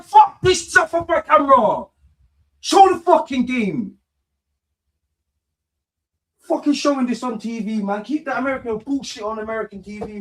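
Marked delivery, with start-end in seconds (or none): 0.0-8.0 angry rant. Real, not acted. Angry at broadcast coverage.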